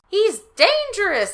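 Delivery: high, loud, slow exclamation; urgent alarm at the kids; exclaimed. Acted.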